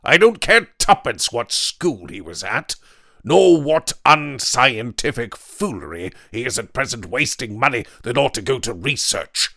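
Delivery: loud, hard refusal; angry Weston. A narrator's reading. Weston refuses to welcome the intruder, loud and angry. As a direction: bark at the intruder. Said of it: "said Weston".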